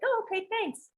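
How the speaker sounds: very high, light mock-flattered voice.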